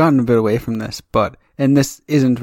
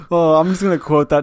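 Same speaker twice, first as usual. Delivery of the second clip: usual speaking voice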